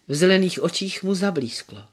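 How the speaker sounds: usual speaking voice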